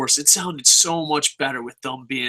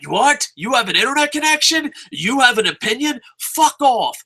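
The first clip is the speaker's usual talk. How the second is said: higher, louder sneering company voice; mimicking Raw scoffing at its fans, loud and high; mocking shout; 8 semitones higher, 5 dB louder than usual. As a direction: bark it like a scornful boss. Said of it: "They're like".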